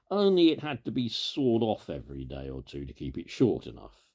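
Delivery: ordinary delivery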